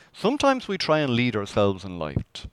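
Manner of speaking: ordinary delivery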